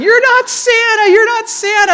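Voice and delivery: loud, high bawling kid imitation; repeated piercing mock scream; crying child's wail. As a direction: wail it like a hysterical little boy. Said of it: "he starts crying really loud".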